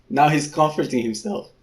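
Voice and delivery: ordinary delivery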